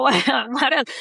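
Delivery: laughing through the question; amused, laughing as you repeat yourself. Real, not acted. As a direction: let a real laugh break into the words. Laughs while repeating own question.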